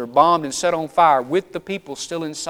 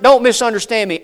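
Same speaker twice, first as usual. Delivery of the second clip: ordinary talk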